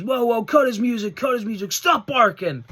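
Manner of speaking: high, loud cocky heel yell; mocking MJF shout. Vlogger mimics MJF cutting off Brody's entrance, mock yell. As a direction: bark it out like a smug heel cutting the music. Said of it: "he comes out and he goes".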